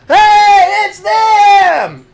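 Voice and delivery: high, loud crowd shout; excited patron shouting at the heroes. Acted. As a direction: yell it across the tavern. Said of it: "And then you hear".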